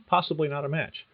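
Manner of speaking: ordinary talk, nothing marked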